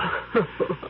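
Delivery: soft scornful chuckle; mocking laugh. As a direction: laugh off his defiance. Witch mocks his confidence.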